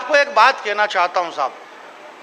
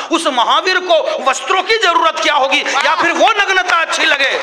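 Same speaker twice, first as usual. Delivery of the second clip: raised, declaimed verse lines; impassioned poem recital; 5 semitones higher than usual. Acted. Poet declaiming about Mahavira, raised. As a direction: land the rhetorical question high.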